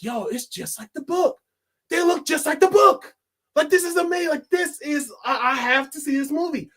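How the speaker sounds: high-pitched, awestruck fan reaction; amazed excitement. Retold or imitated. Fan reacting to the movie trailer, thrilled and amazed. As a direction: act out being thrilled by what you see.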